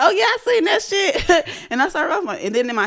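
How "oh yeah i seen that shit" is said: high, offhand retold remark; mock casual reply. Friend shown a Hat Man photo, high offhand reply. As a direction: toss it off lightly, pitched up.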